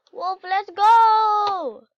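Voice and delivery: drawn-out loud call. Real, not acted. Player calling her dog Wolf, long loud call. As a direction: call the dog to come along.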